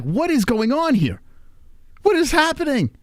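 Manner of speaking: high, alarmed questions; alarmed confusion. Retold or imitated. Dad limping down rock stairs, high alarmed questions. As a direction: ask it panicked as the leg fails. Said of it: "I'm like".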